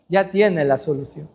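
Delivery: ya tiene la solución sunk low and soft; low, soft resolve; dropped low and soft. Acted. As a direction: drop the voice right down after the peak. Drops after Dios.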